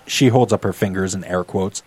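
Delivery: ordinary delivery, nothing marked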